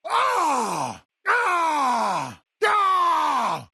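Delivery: high, drawn-out attack yell; battle cry. Acted. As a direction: roar into the attack. Attack cries in combat.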